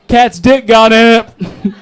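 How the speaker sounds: drawn-out, insistent mock excuse; defensive excuse. Caught out by his friend, defensive excuse. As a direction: double down slowly on a lame lie.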